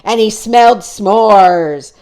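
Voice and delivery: loud, slow, booming storyteller voice. Acted. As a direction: boom it out to scare the listeners. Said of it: "in a booming voice".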